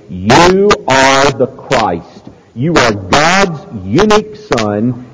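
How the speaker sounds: slow, weighty preached confession; ringing declaration, stretched key words; emphatic proclamation. Acted. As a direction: hammer out each title with mounting conviction.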